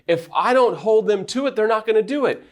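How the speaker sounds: objecting listener. Retold or imitated. Listener objecting to Sam, a lightly mocking defensive voice. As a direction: push back at Sam in a mock-defensive voice. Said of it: "Your rebuttal is".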